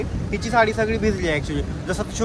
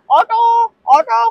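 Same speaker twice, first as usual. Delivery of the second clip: calling out; 12 semitones higher, 5 dB louder, much slower than usual. Real, not acted. Hailing rickshaw in the rain.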